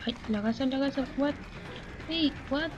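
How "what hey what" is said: ordinary talk, nothing marked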